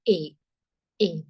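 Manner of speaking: A as a closed "ay"; accent aigu sound. Shown as a demonstration. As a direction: say A as French e-acute. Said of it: "When E comes with accent aigu".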